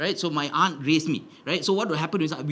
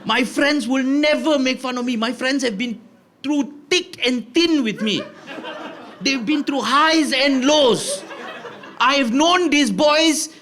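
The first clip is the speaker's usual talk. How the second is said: high, loud, slow mock-heroic speech; hammed-up childish outrage, rising higher and louder; indignant declaration; 8 semitones higher, 4 dB louder, slower than usual. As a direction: build into a grandiose declaration of loyalty. Child indignantly defends his friends.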